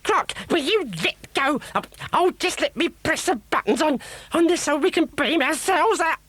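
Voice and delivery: very high, shrill frantic witch; a witch scrambling in a panic. A narrator's reading. Witch struggling with Croc.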